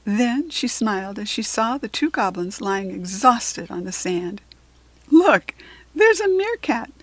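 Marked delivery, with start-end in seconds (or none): 5.1-6.9 high, loud little goblin voice; smallest goblin. A narrator's reading. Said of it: "the smallest goblin said".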